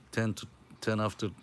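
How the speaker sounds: ordinary delivery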